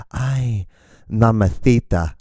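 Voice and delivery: slow "mamacita" with the c as "th"; mock lisped seduction. Disappointing Spanish lover in your ear, lisping. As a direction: lisp it like "mamathita". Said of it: "getting one giant lisp".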